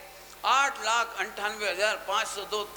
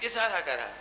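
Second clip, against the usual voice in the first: ordinary delivery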